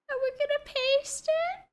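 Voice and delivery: rising into a very high squeak; gleeful squeak. Real, not acted. Gleefully announces copy and paste.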